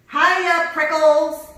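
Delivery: high, very loud teasing hail; shouting. A narrator's reading. Jackrabbit greets the cactus by nickname, shouting. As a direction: shout a greeting while dashing by. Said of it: "she shouted".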